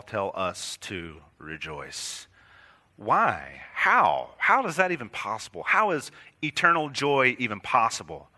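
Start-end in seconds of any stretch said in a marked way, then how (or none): none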